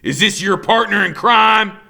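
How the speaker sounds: loud, mocking villain voice; sneering villain. A narrator's reading. Killer Kane mocking Freak, loud and sneering. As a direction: sneer it at the kid.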